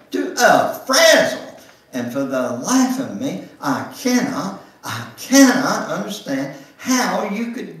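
builds from teasing to a loud high plea; Ruby pressing Emily about dancing, rising to an exasperated high plea; exasperated friend. Acted. Ruby demands an answer, rising.

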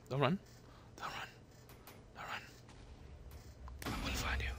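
whispered threat, breathy and low; menacing whisper. Pretend. Hunting a fleeing player, a menacing whisper. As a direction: whisper like a stalker closing in.

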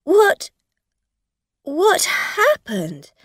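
high, loud, slow frightened stammer; a driver panicking over the injured woman; alarmed. A narrator's reading. Driver finds woman hurt.

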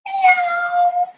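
high cat meow. Shown as a demonstration. Teacher leading kids' yoga, a high cat meow. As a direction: meow like a kitten for the kids. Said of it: "I wanna hear those meows".

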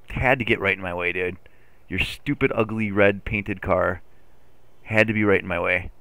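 ordinary talk

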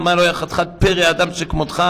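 normal talking voice

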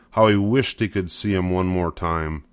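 plain reading voice

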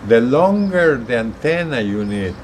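ordinary talk

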